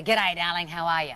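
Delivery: lightly voiced Australian mum; mum's Australian accent. Mum greeting her daughter on the phone, Australian accent. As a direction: greet fondly in broad Australian. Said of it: "my mum calls me".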